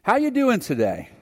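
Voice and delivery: bright, rising friendly officer; cheery cop greeting. Retold or imitated. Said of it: "and said".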